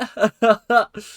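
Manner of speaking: loud, high cartoonish chuckle; goofy laugh. Imitating the smart new mom, goofy put-on laugh. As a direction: let out a silly goofy laugh. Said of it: "Why did I do the goofy laugh".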